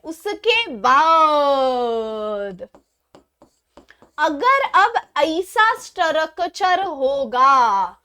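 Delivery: slow, stretched vowels with long held endings; teasing sing-song teacher question; playful drawn-out teasing. Pretend. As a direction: drag out each phrase playfully for the students.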